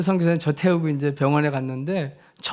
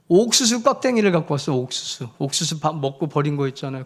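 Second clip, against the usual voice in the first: ordinary delivery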